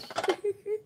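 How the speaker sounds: squealing laugh mid-rant; sudden high cackle; high burst of laughter. Real, not acted. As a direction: crack up high in the middle of the point.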